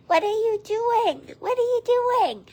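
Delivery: very high singsong to a bird. Real, not acted. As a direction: coo it high, stretching "doing". Baby-talk to the birds.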